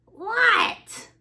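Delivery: very high "What?" falling at the end; yelped reply. Acted. Froggy answers the librarian, high yelped reply. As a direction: squeal it back like a caught child.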